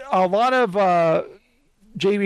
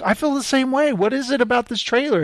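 higher, moved exclamation; emotional excitement; 6 semitones higher than usual. Retold or imitated. Host telling his wife how the trailer moved him. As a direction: gush about the trailer. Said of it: "And I was like".

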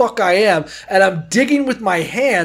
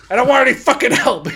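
higher, angry shouted protest; angry refusal; 6 semitones higher than usual. Retold or imitated. Furious kid turning down ski patrol, angry protest. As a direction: push help away in a temper. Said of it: "I'm so angry".

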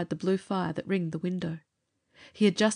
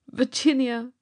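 hopeless call; 6 semitones higher, 5 dB louder, slower than usual. A narrator's reading. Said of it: "he called out in a hopeless tone".

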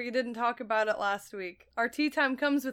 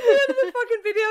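laughing; 10 semitones higher, 6 dB louder, much faster than usual. Real, not acted. Laughing while retelling.

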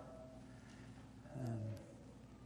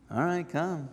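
higher, casual go-ahead; lifted pitch, giving in casually; alternative inflection; 5 semitones higher than usual. Shown as a demonstration. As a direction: try it as an offhand concession. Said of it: "could be so many different things".

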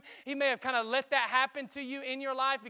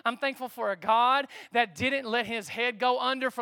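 ordinary delivery

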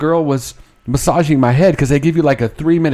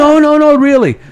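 high, louder insisting; the staff swearing it's true; voiced staff insisting; 11 semitones higher, 6 dB louder than usual.

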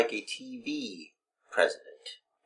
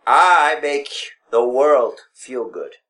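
ordinary talk

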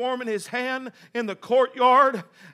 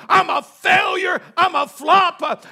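higher, shouted preacher's acting of despair; anguished self-reproach; 5 semitones higher, 6 dB louder, slower than usual. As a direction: cry it out at full force as the broken disciple. Peter berates himself at full force.

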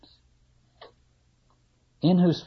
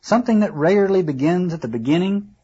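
fussy carpenter; 2 semitones higher, 3 dB louder than usual. Acted. Carpenter complaining about the cobbling job, sniffy. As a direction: grumble like a proud carpenter.

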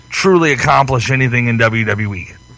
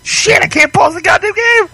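high furious outburst at the game; frustrated yell; 3 dB louder than usual. Real, not acted. Dropped controller, frustrated yell.

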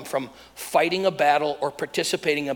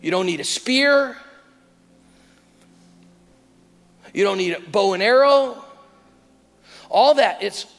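raised, punched lines with long pauses; forceful preaching; 4 semitones higher, 5 dB louder than usual. Real, not acted. Preacher telling congregation the battle is over, forceful proclamation. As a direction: drive each short declaration home to the congregation.

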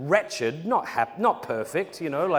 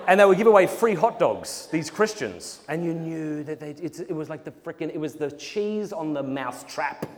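ordinary delivery

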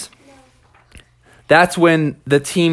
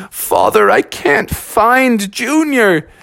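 higher, slow plaintive report; plaintive, stretched-out lament; worried child; 7 semitones higher, much slower than usual. Acted. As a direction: draw it out in dismay. Worried children report to their father.

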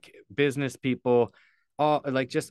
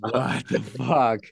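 stunned disbelief; 3 dB louder, much slower than usual. Retold or imitated. Told he's the speaker.

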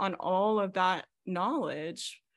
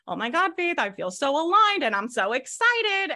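higher, louder gush, imitating an overexcited client; mock gushing client; 7 semitones higher, 5 dB louder than usual. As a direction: play up the client's giddy excitement. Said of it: "they're like".

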